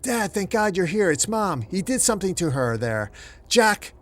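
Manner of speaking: high, frantic calls for her father; terrified crying out. A narrator's reading. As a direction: burst in calling out in panic. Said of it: "completely breathless and terrified, crying out for her father".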